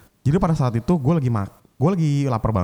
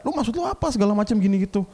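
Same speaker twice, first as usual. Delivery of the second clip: much higher heated demand; retold group-chat tirade; 10 semitones higher than usual. Bombarding the sous chef in the group chat, heated. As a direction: fire the question at him. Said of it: "gue bombardir ya lah di grup".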